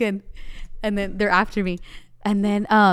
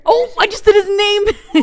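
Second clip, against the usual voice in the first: laughing; 11 semitones higher, 9 dB louder, slower than usual. Real, not acted. Catches her own slip, laughing.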